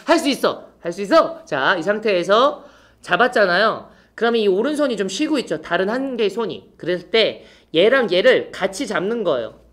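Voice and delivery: ordinary delivery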